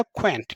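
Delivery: क्वैंट as one syllable, "kw" plus "ant"; dropped vowel. Shown as a demonstration. Teacher showing students the trick, क्वैंट as one-syllable q-plus-ant. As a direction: join a q sound straight onto "ant" for क्वैंट. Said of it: "यहाँ पे ये गायब हो जाएगा".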